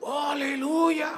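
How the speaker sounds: high, drawn-out cry of praise; mock amazed hallelujah. Preacher seeing the man healed, mock astonished praise. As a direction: stretch it out high, acting overwhelmed.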